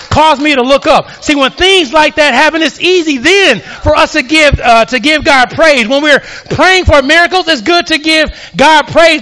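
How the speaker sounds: high, driving testimony to the congregation; full-force preaching. Acted. Preacher telling of God's rescue, high-pitched and forceful.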